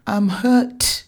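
self-pitying whimper; pitiful and teary. Acted. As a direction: bleat it like a wounded child. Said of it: "His voice was pitiful and teary".

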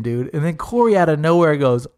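ordinary delivery

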